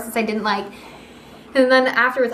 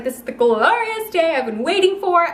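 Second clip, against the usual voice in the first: higher, sing-song mock glee; imitating her own thrilled self, pitched up; mock excited; 7 semitones higher than usual. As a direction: play up the giddy joy of the big day. Quoting her own excitement that day.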